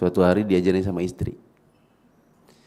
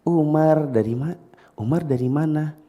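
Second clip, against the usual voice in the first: put-on talking to a child; 5 semitones higher, slower than usual. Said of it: "maka saya tanya".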